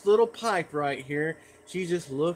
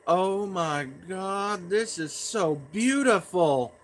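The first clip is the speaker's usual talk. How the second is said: imitating Mom gushing, slow and higher; drawn-out mock-mom admiration; awestruck mom; 4 semitones higher, 4 dB louder, slower than usual. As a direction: slowly marvel in a raised mom voice. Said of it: "I hear her say".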